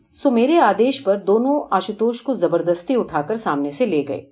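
ordinary delivery, nothing marked

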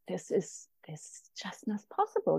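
choked up, dropping to a whisper; overcome, barely getting the words out, very soft; emotional. Real, not acted. Guest recalling her tearful flight over Vermont, emotional whisper. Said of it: "seeing how emotional you get".

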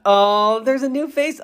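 high, drawn-out coo; cooing delight. Real, not acted. Gushing over the cute Baby Nut.

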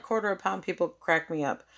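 normal talking voice

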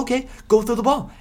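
put-on high, condescending baby-talk to a player; mocking coach voice. Coach teasing his quarterback in a high sing-song voice. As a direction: coax him like a little kid, way up high. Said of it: "Josh Gaz will be like".

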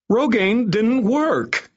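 very high, drawn-out whine; near wail. A narrator's reading. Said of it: "he all but wailed".

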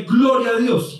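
loud cry of Gloria a Dios; shouted praise. Retold or imitated. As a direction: call it out to get the horse moving. Rider urges the horse on.

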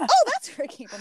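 high, bright discovery; light up as it clicks; mock delighted realization; surprised realization. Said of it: "So I was like".

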